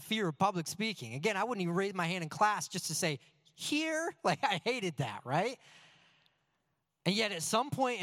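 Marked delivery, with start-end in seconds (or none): 3.5-4.1 small high-pitched voice; timid roll-call answer. Retold or imitated. Shy kid answering in class, timid and high. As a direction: squeak it out as a reluctant pupil.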